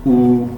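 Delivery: ऊ with rounded lips pushed forward; teacher modelling the vowel ऊ; vowel oo demo. Shown as a demonstration. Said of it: "या वो ऊ".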